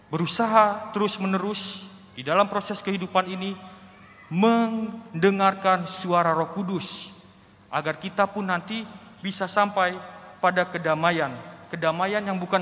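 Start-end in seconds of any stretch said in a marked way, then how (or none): none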